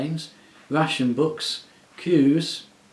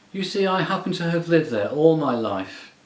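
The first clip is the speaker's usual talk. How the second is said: louder, gloomy marsh creature; gruff Marsh-wiggle voice; 6 semitones higher, 7 dB louder than usual. A narrator's reading. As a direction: speak up like a stubborn marsh-wiggle. Puddleglum protests to the witch.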